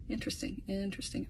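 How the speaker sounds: breathy whisper, drawn inward; whispered musing. Real, not acted. Talking to herself about his secret kid, whispered. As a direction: whisper it as a private suspicion.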